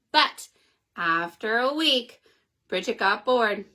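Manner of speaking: ordinary delivery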